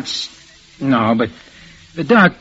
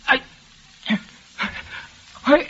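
faltering; 8 semitones higher, slower than usual. Acted. Struggling to stand.

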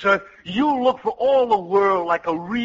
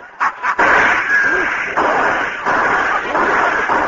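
long, loud, high guffaw; big prank laugh; 8 semitones higher, 5 dB louder than usual. Acted. Laughing at the General after the prank, loud and long. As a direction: let out a long uproarious laugh.

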